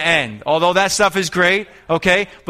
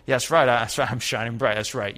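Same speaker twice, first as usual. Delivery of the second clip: lower, softer mock self-satisfaction; prideful; 4 semitones lower, 4 dB quieter than usual. Preacher imitating a believer proud of shining, mock prideful. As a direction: imitate quiet, smug pride. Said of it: "a prideful".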